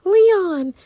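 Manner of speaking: high, stretched-out "Leon"; drawn-out name. Shown as a demonstration. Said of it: "I have to say it like that".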